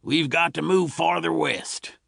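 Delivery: a settler dad fed up with new neighbours; father's voice. A narrator's reading. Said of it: "said Bill's father".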